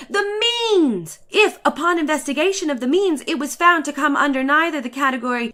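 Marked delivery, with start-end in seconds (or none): 0.1-1.2 much higher, drawn-out exclamation; mock outcry. A narrator's reading. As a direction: parody the pompous savants crying out. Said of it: "they cried".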